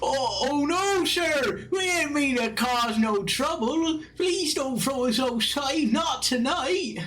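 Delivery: very high, hammed-up begging voice; cowed brawlers pleading. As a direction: plead pitifully in a squeaky high voice. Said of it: "those four men are like".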